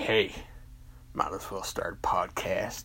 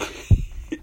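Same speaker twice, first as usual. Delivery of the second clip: a burst of high, loud laughter; a loud, high laughing fit; loud high cackle; 10 dB louder than usual. Acted. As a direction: crack up hard. Cracking up.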